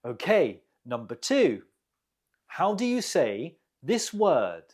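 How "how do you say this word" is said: normal talking voice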